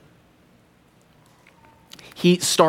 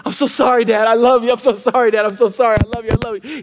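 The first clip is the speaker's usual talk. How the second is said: higher, rushed pleading, lightly acted; mock-tearful, run-together words; imitated tearful apology; 4 semitones higher, faster than usual. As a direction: imitate a small boy blurting apologies. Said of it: "starts crying".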